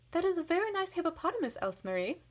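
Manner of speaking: kindly teacher praising a drawing; teacher praise. A narrator's reading. Teacher praising Else Marie's drawing, high and sweet. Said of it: "my teacher says".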